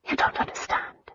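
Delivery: soft, voiceless whisper. A narrator's reading. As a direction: breathe it out, defeated. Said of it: "and then whispered".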